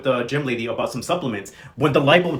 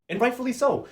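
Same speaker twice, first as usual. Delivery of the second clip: ordinary delivery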